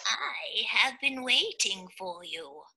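slow, soft wise octopus; deep octopus voice. Acted. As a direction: speak slowly like a wise old octopus. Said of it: "said the octopus with a deep voice".

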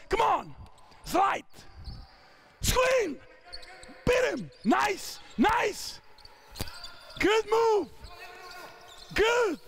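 high, drawn-out courtside calls; calling out. Real, not acted. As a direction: drive the drill and cheer it. Coach calling during live drill.

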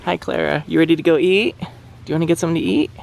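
high, sing-song pet talk; talking to piglet. Real, not acted. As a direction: coax a little piglet sweetly. Calling piglet to come eat.

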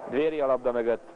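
ordinary delivery

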